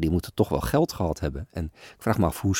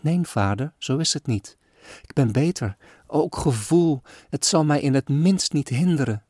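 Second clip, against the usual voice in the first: put-on higher, fragile girl insisting she's fine; pleading child; 7 semitones higher than usual. Sick girl pleads with her father, higher and fragile. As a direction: beg gently like a frail little girl. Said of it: "ving hij droevig aan".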